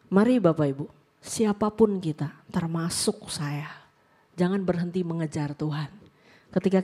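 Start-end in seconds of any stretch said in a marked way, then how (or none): 2.5-3.7 soft slow whisper; whispered aside. Real, not acted. Preacher including herself in the appeal, whispered. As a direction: whisper it as a humble confession.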